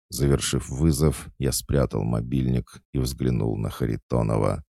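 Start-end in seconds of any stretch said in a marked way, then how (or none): none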